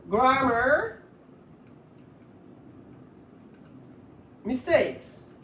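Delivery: stretched words, long gaps between; slow dictation. Shown as a demonstration. Teacher writing out a second option for students, slow dictation. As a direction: dictate it word by word, leaving time to write.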